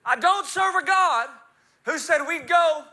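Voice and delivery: loud, high, emphatic proclamation; full-force preaching. Real, not acted. Preacher proclaiming God's glory to the congregation, forceful. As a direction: build it like a Pentecostal preacher.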